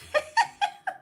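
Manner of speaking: quick high giggle; a short bright laugh. Real, not acted. Teasing viewers about huge boxes, giggles.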